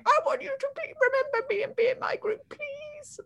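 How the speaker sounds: very high, put-on begging voice; needy plea. Somebody begging to be let into the group, high needy plea. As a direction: plead in a squeaky mock-desperate voice. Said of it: "everything we see is just somebody going".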